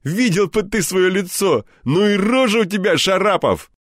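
high, laughing mockery; friend Anton teasing. A narrator's reading. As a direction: poke fun at your buddy's face.

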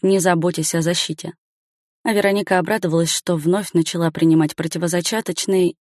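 plain reading voice

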